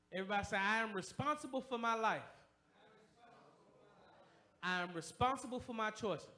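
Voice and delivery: loud, ringing preacher's call to the congregation; full-force preaching. Acted. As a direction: preach it hard and loud, driving each line home.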